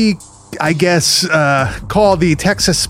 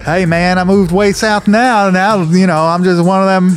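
folksy put-on Southern twang; Southern accent. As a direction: act like a Southern local, keeping up the accent. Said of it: "from a Long Island accent to a Southern accent".

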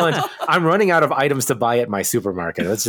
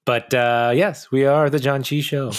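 ordinary talk

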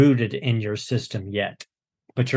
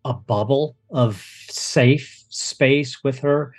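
ordinary talk

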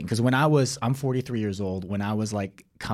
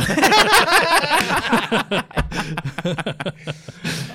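long, high, loud burst of laughter; cracking up for real; big laugh; 6 dB louder than usual. Real, not acted.

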